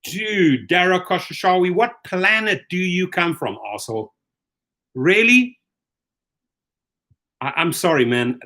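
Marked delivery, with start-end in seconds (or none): 4.9-5.6 incredulous outburst. Real, not acted. Ranting at the Uber CEO.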